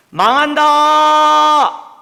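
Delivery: slow, stretched, booming cry of doom; full-throated shout. Shown as a demonstration. Preacher shouting the prophets' warning to shock the listeners. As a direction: raise your voice all the way, like a prophet. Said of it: "이렇게 목청을 막 돋아서".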